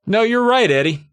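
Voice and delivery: high, emphatic mock agreement, easing down; lightly voiced bully. Bully conceding to Eddie, lightly acted. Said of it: "The bully's being like".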